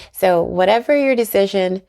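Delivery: ordinary delivery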